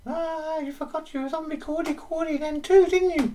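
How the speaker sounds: put-on very high, loud old-lady falsetto; Mrs Doubtfire voice. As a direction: squeak it like a daft granny.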